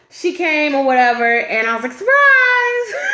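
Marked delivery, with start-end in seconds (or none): 1.9-3.1 high, loud, bright "Surprise!" call; spring it on her like a party reveal; surprise shout. Retold or imitated.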